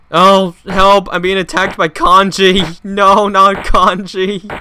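high, loud, drawn-out cry for help; hammed-up terror, stretching out the last words; mock panic. Playful cry for help under attack.

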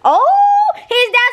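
oh jumps way up, loud and thrilled; delighted surprise. Real, not acted. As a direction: squeal with glee that he agreed. Husband agrees to the challenge.

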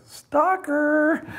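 very high, teasing phone-message cry; high mock call. Musician acting out his prank message to coach, high and teasing. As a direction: sing out an accusation in a mock high voice.

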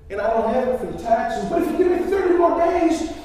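high-pitched, distressed explanation; exasperated, high plea of a sick debtor; pleading woman. Acted. Sick woman pleads over her taxes.

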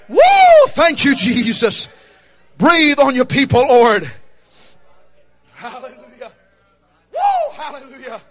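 ordinary talk

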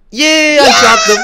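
high, loud victory cry; triumphant yell. Real, not acted. Player yelling in triumph after knocking her rival down. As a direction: shout it out as the rival falls.

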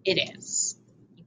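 whispered "it is"; whispered hint. Shown as a demonstration. Teacher whispering a hint to the class. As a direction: breathe it like a secret hint. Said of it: "hinting the answer".

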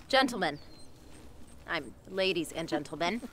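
announcer voice. Acted. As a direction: build up to a loud name reveal. Stage announcement.

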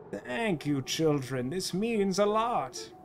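soft, slow grateful voice; tender thanks. Acted. Grown-up thanking the children, soft and tender. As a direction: speak softly and draw it out to the children.

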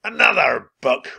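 loud, high outraged roar; growling villain outburst; pirate captain's roar. A narrator's reading. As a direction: roar in disgust like a pirate boss. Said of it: "roared Captain Bones".

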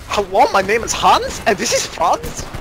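high, put-on German accent; mock German accent. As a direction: introduce yourselves in a cartoon German accent. Friend joins the mock-German act.

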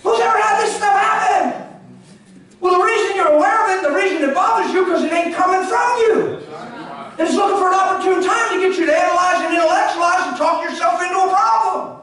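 loud, high, driving sermon voice; full force preaching. Acted. Preacher firing up the church, loud and driving. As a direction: preach it hard to the congregation.

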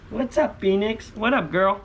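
high sing-song greeting to a pet; pet talk voice. Real, not acted. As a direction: chirp it up high to your hen. Greeting pet chicken Phoenix.

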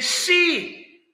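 high, loud "See"; commanding call. Acted. Preacher voicing God showing his hands, commanding call. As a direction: call out to make them look. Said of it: "And he's saying".

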